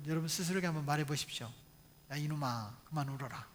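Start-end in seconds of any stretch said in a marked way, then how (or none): 2.1-3.5 low, soft self-reproof; hushed and subdued, demonstrating self-talk; calm inner voice. Shown as a demonstration. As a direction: tell yourself off quietly, as conscience would. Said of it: "잔잔한 음성이 있었어요".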